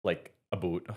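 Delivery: a hint of Canadian accent. Real, not acted. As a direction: lightly say about as a boot. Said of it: "My Canadian accent's coming out".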